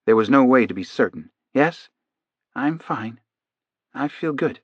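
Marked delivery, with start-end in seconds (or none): 1.5-4.6 soft, higher, drowsy-trance answers; reply softly from inside a trance; entranced patient. A narrator's reading. Hypnotized man reassuring his doctor, softly entranced.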